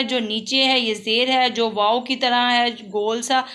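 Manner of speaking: ordinary talk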